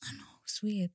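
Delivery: soft whispered aside; whispering. Real, not acted. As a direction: hush it to yourself.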